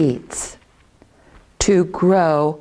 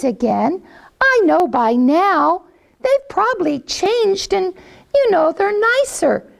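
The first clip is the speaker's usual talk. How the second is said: high, sweet mother hen talking to her chicks; mama hen coaxing her babies; 8 semitones higher than usual. Acted. Hen decides to ask again.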